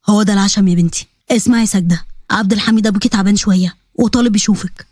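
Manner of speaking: low voice, gently pleading. Acted. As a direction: ask a favour like a loving uncle.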